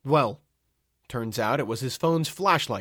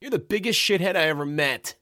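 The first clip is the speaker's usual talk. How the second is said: irate, higher-pitched insult; chewing out; 6 semitones higher, 3 dB louder than usual. A narrator's reading. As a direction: berate someone as an angry stranger. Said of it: "chewing me out".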